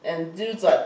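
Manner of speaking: normal talking voice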